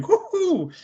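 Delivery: high hooting wolf-whistle "woohoo"; imitated approving whistle. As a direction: hoot it high, like an impressed whistle. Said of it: "whistles, you know, approvingly".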